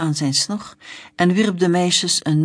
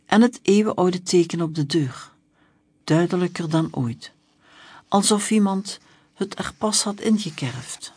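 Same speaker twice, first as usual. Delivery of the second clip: usual speaking voice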